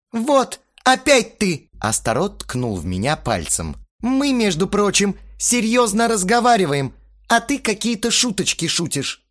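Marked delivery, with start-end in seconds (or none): 0.1-1.7 slow, high, loud accusation; heated accusation. A narrator's reading. Accusing the narrator mid-quarrel. 4.0-9.3 high, raised, offended voice; indignant reproach. A narrator's reading. Astarot indignant at the joking.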